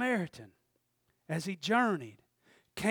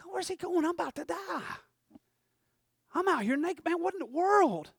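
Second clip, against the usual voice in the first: dismayed wounded man; 10 semitones higher than usual. Acted. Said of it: "and he's like".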